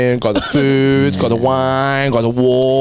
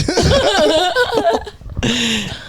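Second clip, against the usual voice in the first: laughing. Real, not acted. Laughing at own joke.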